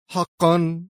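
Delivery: high, mocking question. A narrator's reading. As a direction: ask it with open sarcasm. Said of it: "قال في سخرية أكثر". (بسخرية)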